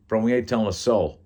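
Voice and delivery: loud New York Italian guy; lightly put-on New York Italian voice; New York Italian accent. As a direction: play him as an Italian New Yorker. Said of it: "I'll make Tony Italian".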